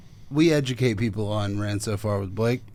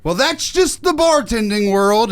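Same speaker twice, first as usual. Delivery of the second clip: mock angry manager; 9 semitones higher, 6 dB louder than usual. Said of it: "and he goes ... hung up angrily".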